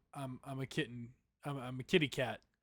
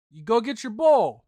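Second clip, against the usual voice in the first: loud, higher command to a pet; owner ordering cat; 5 semitones higher, 13 dB louder than usual. Pretend. Owner telling cat to fetch bowl, loud order.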